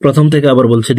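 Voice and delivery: ordinary delivery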